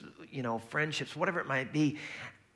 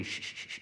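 short unvoiced shushes; quick, soft repeated shushing; mock hushing; 8 dB quieter than usual. Dad trying to hush a crying baby, quick soft shushes. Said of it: "for me, it'll be".

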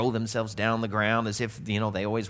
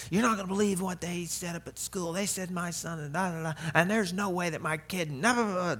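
complaining parent; 6 semitones higher, 3 dB quieter than usual. Retold or imitated. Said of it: "it would be like".

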